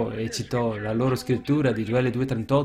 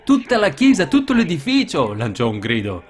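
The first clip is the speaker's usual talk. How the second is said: much higher and louder on the first words; preaching shout; 5 semitones higher, 5 dB louder than usual. Real, not acted. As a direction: cry out the climax to the congregation. Climax of the sermon story. (il predicatore grida il culmine alla chiesa)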